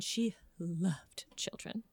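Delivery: slow, soft, near-whispered; hushed and drawn out; hushed adoration. Real, not acted. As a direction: drop to a lingering breath of a whisper. Hushed take before loud repeat.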